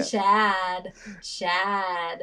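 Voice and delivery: imitating an exaggerated American drawl; mock American accent. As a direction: echo the name in mock American.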